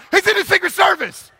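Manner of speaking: high, loud public announcement; mock boastful shout. Man outing his Secret Service cousin at Zaxby's, mock shouting. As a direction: blurt it out to the whole room, hammed up. Brags loudly about cousin in restaurant.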